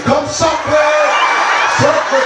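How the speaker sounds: high, loud patois yelling; friend yelling. Retold or imitated. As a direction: join in and shout the taunt. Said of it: "Friend jumped right in".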